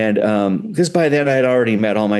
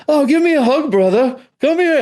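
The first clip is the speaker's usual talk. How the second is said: much higher and louder, delighted welcome; hearty greeting; 5 dB louder than usual. Retold or imitated. Paul welcoming the nervous visitor, loud and delighted. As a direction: throw your arms open, beaming. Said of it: "And he goes".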